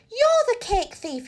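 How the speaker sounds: high, sharp accusation with a frown; accusing cry. A narrator's reading. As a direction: point at the thief and cry it. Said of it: "he cried with a frown".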